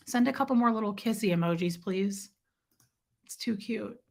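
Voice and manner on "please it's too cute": usual speaking voice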